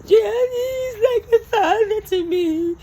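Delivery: high, slow, whiny mock voice; mocking Naomi's words. Host mocks Naomi's claim about her late father, high and whiny. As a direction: whine it out, mocking her grief. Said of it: "But then you said".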